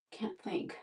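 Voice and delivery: breathy whisper to herself. Real, not acted. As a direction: mutter it under your breath. Distracted, can't think.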